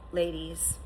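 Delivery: ordinary talk, nothing marked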